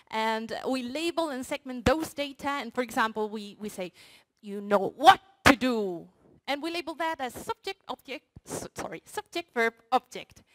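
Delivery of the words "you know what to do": "what" stressed, loud and held; emphasis on what. Shown as a demonstration. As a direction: push the word what hard. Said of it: "and emphasis on what? Wrong".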